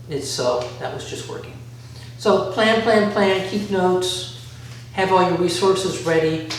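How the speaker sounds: ordinary talk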